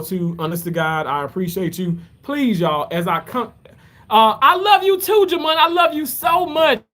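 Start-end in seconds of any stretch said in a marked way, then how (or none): 4.1-5.6 excited love. Real, not acted. Loving shout-out to nephew Jamon. 5.6-6.8 high, loud, gushing I love you; excited shout of love. Real, not acted. Uncle calling out love to his nephew, excited.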